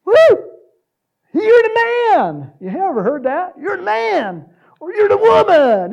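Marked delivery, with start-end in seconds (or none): 0.0-2.5 high, very loud whooping cheer; drawn-out, full-voiced cheer; shout out. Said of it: "It's a shout out". 3.6-4.5 very high, loud fan cheer; crowd-style holler; shout out. Acted. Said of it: "It's a shout out". 4.9-5.9 high, very loud whooping cheer; shout out. Acted. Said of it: "It's a shout out".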